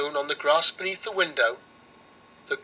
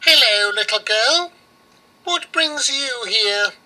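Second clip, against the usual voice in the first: witch voice; 6 semitones higher, 4 dB louder than usual. Acted. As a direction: draw it out like a sly old hag. Baba Yaga greets the girl.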